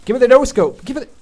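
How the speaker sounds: loud, high pleading call; excited demand. Real, not acted. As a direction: beg the game for it. Demanding the kill.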